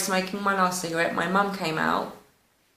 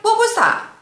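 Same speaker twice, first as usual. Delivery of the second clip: much higher and louder startled cry; shocked; 14 semitones higher, 6 dB louder than usual. Retold or imitated. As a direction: blurt it out, stunned by a slap. Just slapped in a dream.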